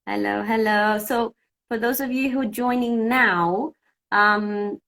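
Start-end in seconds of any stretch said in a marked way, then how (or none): none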